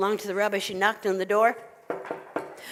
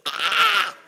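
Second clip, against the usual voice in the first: loud, high scream; crazed scream; 13 semitones higher, 7 dB louder than usual. Acted. Old woman driven crazy by animals, high scream. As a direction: shriek as the woman snaps.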